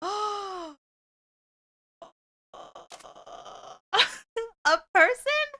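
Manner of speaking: high startled gasp; scared horror game streamer. Real, not acted. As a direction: jump at the sudden figure. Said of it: "Don't jump scare us".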